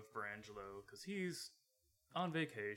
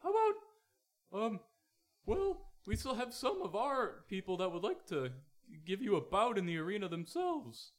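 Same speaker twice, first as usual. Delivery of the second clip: high excited announcer; 8 semitones higher, 6 dB louder than usual. Acted. GM voices Wenselton.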